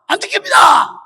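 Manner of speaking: high, loud schoolboy answer; student's shouted reply. Retold or imitated. Student answering an angry gym teacher, high shout. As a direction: yell it out like a pupil answering. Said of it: "이 학생이 이름이 안 듣기야".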